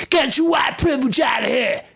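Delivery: high, mocking yell; mock shout. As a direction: scream it like a caricature. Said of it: "comes flying out of your mouth".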